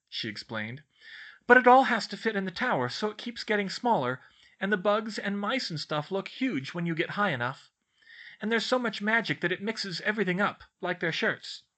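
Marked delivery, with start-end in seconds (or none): 1.5-11.6 put-on high, louder studious girl voice; bookish girl explaining. A narrator's reading. Girl explains her maths book to friends, earnest.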